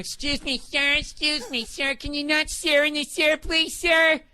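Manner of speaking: high, polite flight attendant; stewardess voice. Retold or imitated. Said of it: "the stewardess going".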